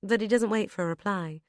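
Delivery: plain reading voice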